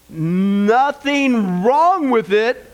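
very slow, stretched words; drawn-out catchphrase. Shown as a demonstration. Pastor leading the congregation in his catchphrase, long drawn-out chant. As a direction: stretch every word for the crowd to join in.

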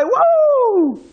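high, excited crowd whoop; joyful cheer. Retold or imitated. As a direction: whoop like a crowd erupting with joy. Crowd erupts with joy.

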